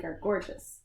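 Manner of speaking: plain reading voice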